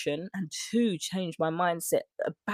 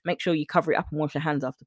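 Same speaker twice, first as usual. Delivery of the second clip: usual speaking voice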